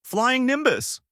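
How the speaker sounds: high, ringing summoning call; yelling out. A narrator's reading. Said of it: "as he yelled out".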